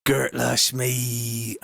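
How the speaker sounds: very slow, drawn-out West Country catchphrase; West Country accent. Shown as a demonstration. Streamer showing viewers the accent, slow West Country. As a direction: stretch it right out in West Country. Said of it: "West Country a-, accent".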